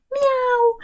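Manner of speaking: high playful meow; teacher meows like the cat. Acted. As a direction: mew like a cat. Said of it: "le chat".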